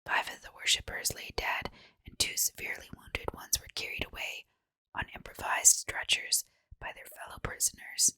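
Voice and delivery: ordinary delivery, nothing marked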